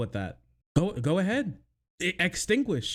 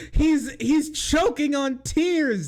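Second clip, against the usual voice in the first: high, slow, laughing through the words; cracking up while mocking him; giggling mockery; 8 semitones higher, 4 dB louder, slower than usual. Real, not acted.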